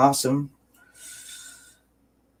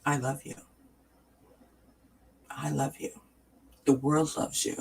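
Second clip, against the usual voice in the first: soft, hushed affection; tender self-love; 8 dB quieter than usual. Shown as a demonstration. Tender self-love in mirror, tearing up.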